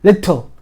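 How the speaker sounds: little with li meeting a clear t; two sounds. Shown as a demonstration. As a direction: hit the t in little. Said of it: "the two sounds, li and t".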